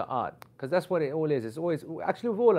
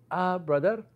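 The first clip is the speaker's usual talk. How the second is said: put-on higher-pitched Pakistani-accented woman; 6 semitones higher, slower than usual.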